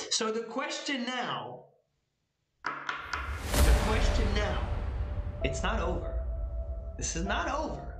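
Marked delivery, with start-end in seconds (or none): none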